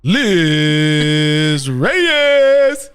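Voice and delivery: announcer voice. Pretend. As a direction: boom the name into the mic. Testing mic with announcer call.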